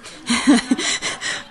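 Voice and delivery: a quick breathy laugh at herself; short laugh. Real, not acted. Author joking with the audience, a short light laugh.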